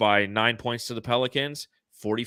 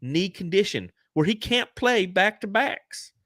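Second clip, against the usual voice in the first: mock know-it-all poster; 9 semitones higher, slower than usual. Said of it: "and they go".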